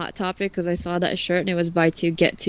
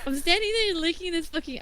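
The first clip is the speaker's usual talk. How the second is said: laughing through the words; giggling as she tells it; 8 semitones higher, 4 dB quieter than usual. Real, not acted. Laughing while telling the story.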